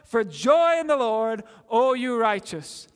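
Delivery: ringing scripture read from the pulpit; proclaimed psalm reading. Acted. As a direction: call the verses out like a summons to praise. Preacher reads psalm call to joy.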